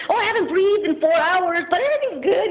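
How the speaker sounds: mock cheerful. Parodying her own casual recovery answer in mock cheer. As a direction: sell it as if it were fine.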